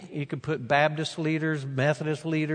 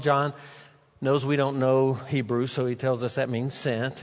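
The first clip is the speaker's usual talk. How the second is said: ordinary talk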